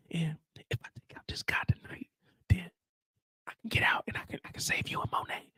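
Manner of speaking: urgent hushed whisper. Retold or imitated. Drew pleading with Diana from jail, whispering. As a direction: whisper fast, trying to stop her.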